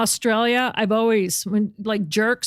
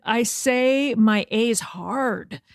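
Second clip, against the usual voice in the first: very slowly, word by word; slow explaining; much slower than usual. Retold or imitated. Host explaining her vowels, slow and deliberate. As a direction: spell it out slowly. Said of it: "I said".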